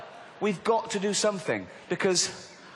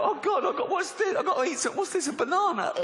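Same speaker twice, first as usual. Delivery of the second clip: high-pitched, hammed-up starving panic; comic famished whine; mock desperation; 12 semitones higher than usual. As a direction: beg frantically for anything to eat. Acts starving person finding only fruit.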